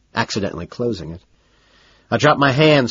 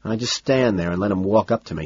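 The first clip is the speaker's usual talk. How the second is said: plain reading voice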